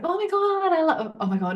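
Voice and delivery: mock fake-friendly gush that shoots up on the first oh my God; hammed-up insincere squeal. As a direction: gush at a stranger like a Mean Girls type. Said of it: "try and be fake and go and be like".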